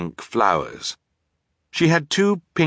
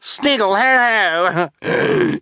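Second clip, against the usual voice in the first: very high shrieked fake laugh; piercing villainess shriek; 5 dB louder, much slower than usual. A narrator's reading. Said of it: "Esme shrieked".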